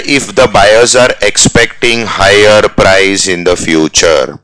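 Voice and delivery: very slow dictation of the question; slow read-aloud. Shown as a demonstration. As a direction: read it out word by word. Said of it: "reading question slowly".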